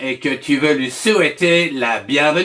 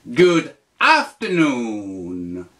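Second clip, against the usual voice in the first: slow, lower repeat; 6 semitones lower, much slower than usual. Shown as a demonstration. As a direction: repeat it slowly, a bit lower. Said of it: "tu dis".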